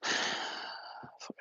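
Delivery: normal talking voice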